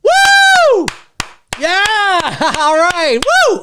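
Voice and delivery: high, loud whooping cheer; genuine cheering. Shown as a demonstration. Showing fans what real cheering sounds like, high happy whoops. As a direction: cheer like a real excited fan. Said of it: "There's this kind of stuff".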